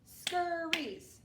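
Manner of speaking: Scurries clapped in two beats; clapped syllables. Shown as a demonstration. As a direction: clap out Scurries. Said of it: "let's count our syllables".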